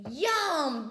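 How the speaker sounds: loud, high, savoring "yum"; big storybook exclamation of delight; delighted bear. A narrator's reading. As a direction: relish the food with gusto. Bear enjoys breakfast in story.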